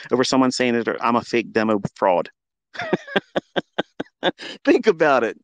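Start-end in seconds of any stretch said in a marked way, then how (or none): 2.7-4.6 high, scornful burst of laughter. Real, not acted. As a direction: laugh off the accusation. Scoffing at being called fraud.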